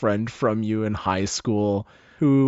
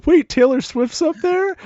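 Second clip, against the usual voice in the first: surprised fan; 4 dB louder than usual. Acted. Crowd member surprised.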